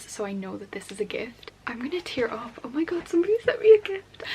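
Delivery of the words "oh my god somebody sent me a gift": high, loud delighted cry; excited squeal. Real, not acted. Opening a surprise gift from a viewer, excited squeal.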